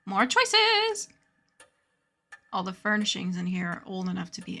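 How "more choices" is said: excited. Real, not acted. Excited game reaction.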